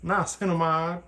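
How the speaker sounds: playful accent on Niacinamide; put-on accent. Shown as a demonstration. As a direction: say Niacinamide like an exaggerated foreign accent. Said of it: "I don't know why I wanted to say it with that accent".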